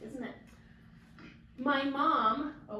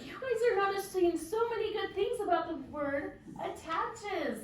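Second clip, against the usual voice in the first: enthusiastic praise; 8 semitones higher, 3 dB louder than usual. Acted. Praising the class.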